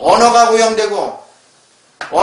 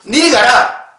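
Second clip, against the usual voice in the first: Gyeongsang dialect; 5 semitones higher, 4 dB louder, slower than usual. Shown as a demonstration. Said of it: "경상도 말은".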